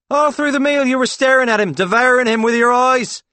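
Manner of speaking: loud, high-pitched accusation; hammed-up jealous shouting for an audience; jealous outburst. Paul accusing Claude before the diners, jealous shouting. Staged jealous row at the table.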